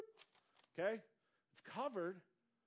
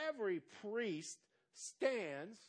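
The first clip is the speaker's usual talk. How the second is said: very slow, deliberate reading of each word; 3 semitones higher, much slower than usual. Shown as a demonstration. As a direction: read it out slowly for the church. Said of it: "I'm gonna read this real slow".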